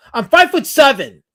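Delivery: loud, high indignant outburst; indignant correction. Real, not acted. Streamer correcting a height taunt from chat, indignant. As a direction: shout it back at the heckler.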